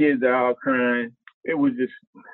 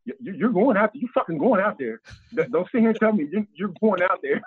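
insistent Fox; 8 semitones higher than usual. Retold or imitated.

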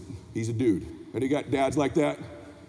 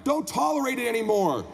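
high, driving motivational sermon; motivational preaching; 10 semitones higher than usual. Acted. Speaker urging parents to stop tolerating less, impassioned preaching. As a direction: preach it at full force, leaning on key words. Motivational speech at full force.

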